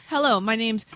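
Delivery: usual speaking voice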